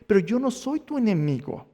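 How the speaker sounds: high, insistent reassurance; Jesús. Acted. Jesus corrects Saulo about who his enemy is, high insistent plea. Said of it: "Jesús dice".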